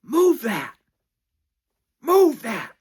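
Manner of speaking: high, loud barked order; angry shout. Retold or imitated. Churlish old man ordering the mover, loud angry yell. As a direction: bark it at the mover. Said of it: "And he's like".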